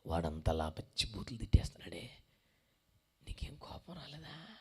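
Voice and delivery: hushed whispered question at a bedside. Retold or imitated. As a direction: whisper it so others won't hear. Preacher whispers question to patient.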